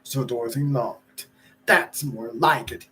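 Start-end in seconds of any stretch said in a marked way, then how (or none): 1.7-2.9 lightly voiced porter yell. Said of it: "yelled the porter".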